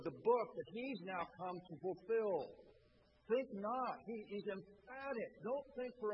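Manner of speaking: loud, emphatic preaching. Real, not acted. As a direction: press every word home to the congregation. Preaching at full force.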